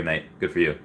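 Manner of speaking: usual speaking voice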